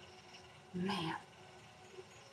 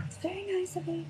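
high sweet voice; 11 semitones higher than usual. Real, not acted.

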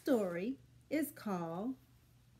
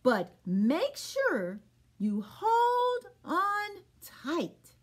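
slow warning horse; 6 semitones higher, much slower than usual. Acted. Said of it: "says Mister Horse".

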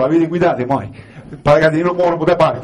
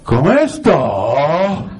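wife asking; 3 semitones higher than usual. Retold or imitated. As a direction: ask it like a wife waiting for praise. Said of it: "ti guarda negli occhi e dice". (la moglie chiede con voce leggermente civettuola)

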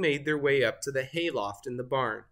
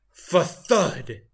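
sound effect; 6 dB louder than usual. A narrator's reading.

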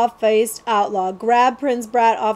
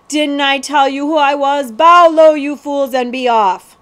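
higher, louder royal command; haughty prince; 5 semitones higher, 9 dB louder than usual. Acted. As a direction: order the fools to bow. Spoiled prince commands outlaws.

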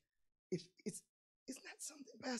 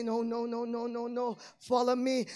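voice of Jesus; 7 dB louder than usual. Acted.

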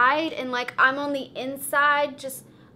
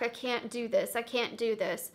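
much softer, fading despairing repeat; sinking into panic, almost to herself; quiet pleading; 4 semitones lower, 10 dB quieter than usual. Retold or imitated.

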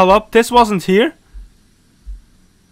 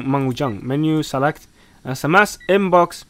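ordinary delivery

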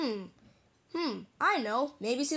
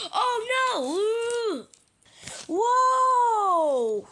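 high, loud falling cry; dramatic pretend crash scream; panicked yell; 9 semitones higher, 5 dB louder, much slower than usual. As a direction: wail as if tumbling over. Toys crash during the chase.

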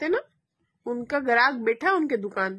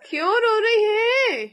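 drawn-out, higher-pitched coaxing; coaxing question; 6 semitones higher, slower than usual. Real, not acted. Mother asks crying child.

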